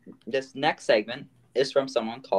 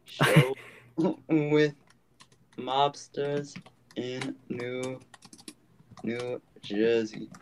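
halting put-on New Jersey accent, trailing softer; 4 dB quieter, much slower than usual. Host looking up a show, New Jersey accent. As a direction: piece it out slowly in mock New Jersey. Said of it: "That was my New Jersey accent".

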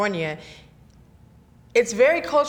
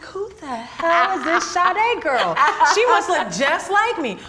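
high, slow, laughing disbelief; retelling her confusion, baffled and laughing; 9 semitones higher, 5 dB louder, slower than usual. Retold or imitated. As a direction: laugh at your own confusion. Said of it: "I was like".

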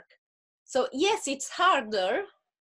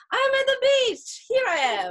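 mock glamour pose; 12 semitones higher, 6 dB louder than usual. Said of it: "I'm like".

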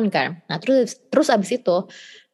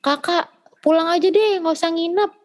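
higher-pitched mock mom nagging her kid; imitating scolding mom; 7 semitones higher than usual. Mom scolding daughter for staying over, higher mock nag. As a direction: play mom scolding, lightly put on. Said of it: "nyokap gue ngechat".

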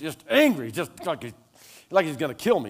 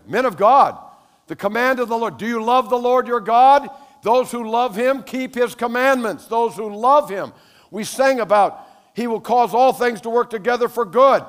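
loud, high, driving sermon voice; full-force preaching; 8 semitones higher, 7 dB louder than usual. Real, not acted. Pastor exhorting the men of God, loud and high.

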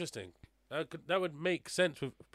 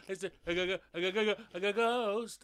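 cartoon fright at seeing a ghost; stuttering, hammed-up terror; mock panic; 6 semitones higher, 5 dB louder than usual. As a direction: stammer higher and louder up to a stretched "ghost". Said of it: "everyone's like".

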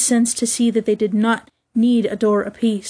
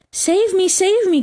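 high, urgent plea for help; cried out; 9 semitones higher than usual. A narrator's reading. Nibs chased by a pack of wolves, crying out. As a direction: cry out for rescue in a high voice. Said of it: "cried Nibs".

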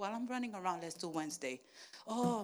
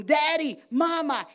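put-on high, loud child calling; 8 semitones higher, 14 dB louder than usual. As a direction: tug for attention like a toddler. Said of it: "the little one going".

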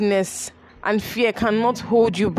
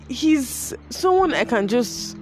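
ordinary talk, nothing marked

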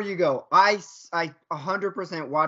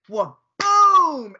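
high, loud boom; explosive impact; 8 semitones higher, 9 dB louder than usual. Retold or imitated. Friend acting out the hit heard in the video, explosive shout. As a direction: blurt it like a sudden crash.

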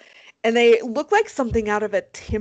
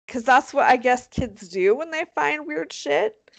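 normal talking voice

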